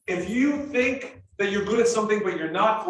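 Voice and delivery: normal talking voice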